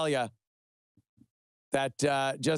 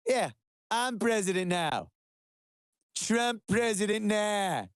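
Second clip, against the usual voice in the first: higher, brash put-on Trump voice, fast at the end; mock Trump; 4 semitones higher than usual. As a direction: do a dismissive hammed-up Trump brushing off the deal. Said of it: "he's just like".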